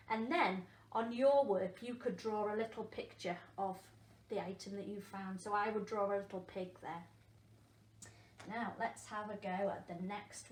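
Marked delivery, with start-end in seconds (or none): none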